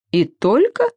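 high, mocking question; scoffing amusement. A narrator's reading. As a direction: scoff with a rising lilt. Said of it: "Расхохотались пауки".

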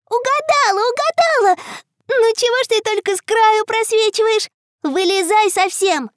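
high, lively, searching; playful little girl. Acted. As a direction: hunt playfully for a hidden friend.

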